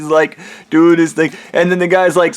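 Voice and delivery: ordinary talk, nothing marked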